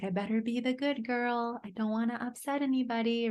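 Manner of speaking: lightly voiced eager little girl; little Amber voice. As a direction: sound like a child anxious to please. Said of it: "that's my little Amber voice".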